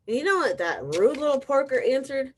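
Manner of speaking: usual speaking voice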